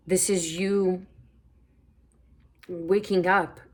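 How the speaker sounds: hiccups breaking the words; hiccuping. Real, not acted. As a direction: speak through hiccups. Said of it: "Excuse me, I'm hiccuping".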